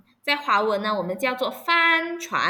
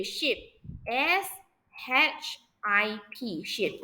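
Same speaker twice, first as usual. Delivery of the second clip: slow spelling; 6 dB quieter, much slower than usual. Shown as a demonstration. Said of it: "老师慢速拼读单词".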